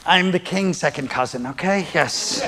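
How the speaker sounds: soft, understated aristocrat voice; lightly voiced royal cousin. Acting a smug royal relative, hushed.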